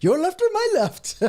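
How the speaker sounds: a bad jokey Indian accent, two guys arguing over directions; mock Indian accent. Joking about rover tracks, mock Indian accent squabble. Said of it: "I can't do the accent".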